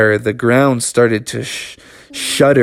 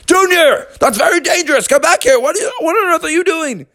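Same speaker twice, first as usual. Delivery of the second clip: scolding father bear; faster than usual. Acted.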